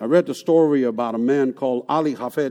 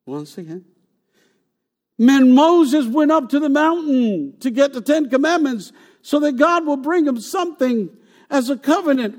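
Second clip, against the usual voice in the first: ordinary delivery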